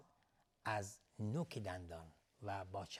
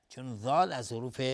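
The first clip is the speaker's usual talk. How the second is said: ordinary talk, nothing marked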